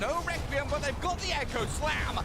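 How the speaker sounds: fast, high hype call of the fight; excited play-by-play. Acted. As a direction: ride the action like a live caster. Caster hyping a teamfight.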